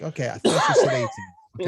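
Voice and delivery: high, loud put-on voice of realization; high, loud comic double-take; mock surprise. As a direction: squeak it out like you just caught on. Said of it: "I was like".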